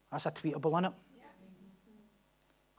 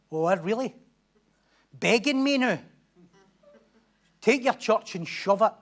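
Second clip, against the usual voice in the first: raised, scornful voice of the caller; mock angry retort; 6 semitones higher, 7 dB louder, slower than usual. Offended member snapping back at the pastor, mock angry.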